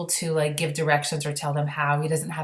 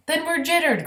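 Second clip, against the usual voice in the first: a much higher, alarmed general's cry; 12 semitones higher, 3 dB louder than usual. Acted. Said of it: "cried the Army General".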